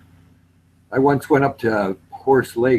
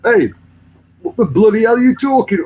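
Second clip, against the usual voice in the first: high, loud broad English accent; hammed-up English exclamation; English accent demo; 12 semitones higher, 7 dB louder than usual. Shown as a demonstration. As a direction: exaggerate a northern English accent. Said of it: "I'm still speaking like".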